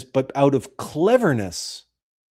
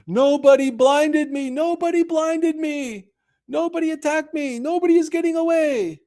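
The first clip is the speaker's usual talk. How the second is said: much higher, hammed-up giant's shout; Cyclops shouting. Blinded Cyclops shouting to the other giants, hammed-up high shout. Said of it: "and shouts".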